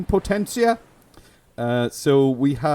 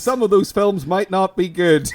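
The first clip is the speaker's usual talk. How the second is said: hammed-up genie; 5 semitones higher than usual. Host revealing the catch to co-host, hammed-up genie. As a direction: deliver it as a gloating genie's twist. Playing a wicked genie granting wish.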